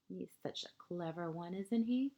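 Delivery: ordinary delivery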